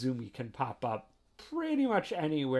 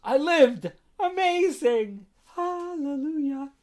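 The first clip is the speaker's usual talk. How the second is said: relieved elation; 13 semitones higher, 8 dB louder, slower than usual. Retold or imitated. Relieved after safe takeoff.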